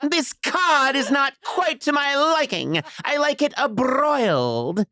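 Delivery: put-on high, slow, prim kid voice; fussy little gourmet; prissy child. Son complains about the cod. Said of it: "kid complaining about fish".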